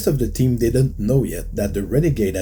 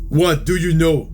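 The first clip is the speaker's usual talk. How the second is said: demanding; 7 semitones higher, 4 dB louder than usual. Acted. Knockout confronts the officer.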